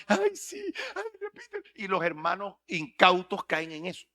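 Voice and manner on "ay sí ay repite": very high, laughing squeal; mocking gullible believer. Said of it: "pero uno ve". (creyente ingenuo en burla, chillón y riendo)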